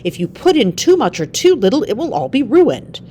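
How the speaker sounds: angry scientist scolding his assistant, loud; angry snap. A narrator's reading. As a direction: bark the warning at him. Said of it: "Almer snapped at his assistant".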